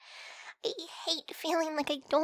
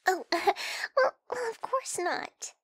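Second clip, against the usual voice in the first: nervous laugh; 4 semitones higher, 3 dB louder, much slower than usual. Acted. Flustered denial about last night.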